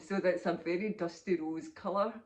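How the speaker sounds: ordinary delivery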